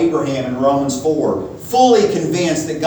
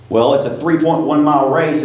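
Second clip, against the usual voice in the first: normal talking voice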